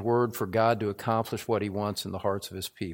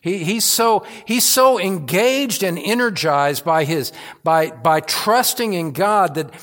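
loud, climbing pulpit delivery, pressing each phrase; full-force preaching; 5 semitones higher, 5 dB louder than usual. Acted. Pastor preaching to his congregation, loud and fervent. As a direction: preach it out with rising fervour.

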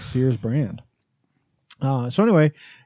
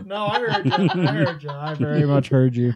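laughing. Real, not acted. Teasing after overheard remark.

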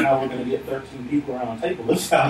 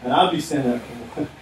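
ordinary talk, nothing marked

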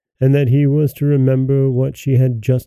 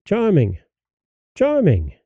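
calling out; 6 semitones higher than usual. A narrator's reading. Unseen voice calling Charming from the water. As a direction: call his name across the river bank. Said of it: "the voice called again".

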